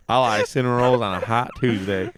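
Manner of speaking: imitating a Texas accent while amused. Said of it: "just the best".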